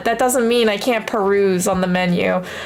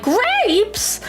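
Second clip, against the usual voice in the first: high, delighted Grapes; dawning joy; 9 semitones higher than usual. Retold or imitated. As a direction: perk up at Grapes. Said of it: "Oh, I'm so excited".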